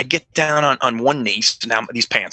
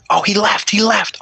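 retold frantic witness; 9 semitones higher than usual. Witness telling the cop, retold.

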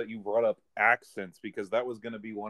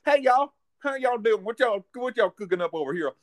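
exaggerated Southern accent, pitched way up; 9 semitones higher, 5 dB louder than usual. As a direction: ham up a folksy Southern greeting. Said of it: "you can't have a Southern accent".

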